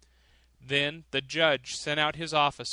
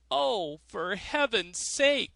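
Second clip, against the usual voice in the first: higher, slow pained plea; miser crying out; 7 semitones higher, slower than usual. A narrator's reading. Miser begging from the thornbush, higher and pained. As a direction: cry out in pain from the thorns. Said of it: "cried the miser".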